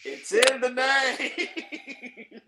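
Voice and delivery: high, slow, laughing through it; laughing punchline. Real, not acted. Repeating his own joke to the hosts, laughing. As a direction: drag it out while laughing. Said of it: "repeats punchline laughing".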